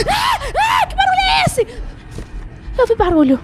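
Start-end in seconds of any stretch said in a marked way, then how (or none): 0.1-1.7 high, startled cry of fright; startled scream. Real, not acted. Player startled by a sudden noise, screaming. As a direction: jump and cry out.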